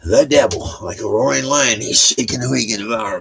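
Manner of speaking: loud, high, preacher-style declaration; preachy scripture quote. Acted. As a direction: boom it out like a sermon. Quoting scripture with force.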